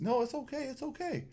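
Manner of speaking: high, light, soothing voice; pitched well up, softening as it goes; retold reassurance. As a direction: imitate someone gently waving off an apology. Retold man waving off apology.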